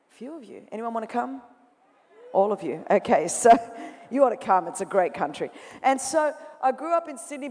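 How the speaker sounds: ordinary talk, nothing marked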